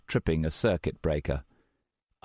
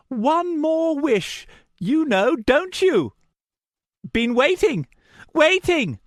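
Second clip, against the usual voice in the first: high, slow, creepy lunatic voice; crazed madman; 7 dB louder than usual. A narrator's reading. Madman tempting with the last wish: high eerie voice. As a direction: taunt like a man gone mad. Martin's mad voice.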